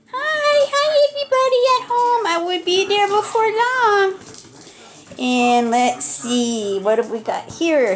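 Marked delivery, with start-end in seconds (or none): 0.1-4.2 high, fast little puppy voice. Acted. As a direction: speak for the puppy, high and quick. Voicing the puppy's greeting.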